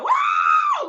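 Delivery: very high, loud shouted wow; imitating the cook's thrilled wow; amazed wow. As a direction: blow the roof off with amazement. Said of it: "Like that".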